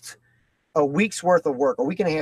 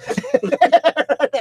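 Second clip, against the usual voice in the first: high laugh; 13 semitones higher, 5 dB louder than usual. Real, not acted. Laughs after daughter quote.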